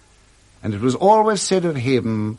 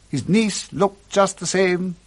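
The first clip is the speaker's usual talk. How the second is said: ordinary talk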